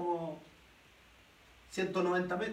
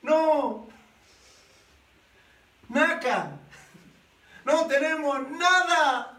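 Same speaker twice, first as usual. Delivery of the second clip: embarrassed shout; 4 semitones higher, 4 dB louder, slower than usual. Acted. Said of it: "tienen que gritarle".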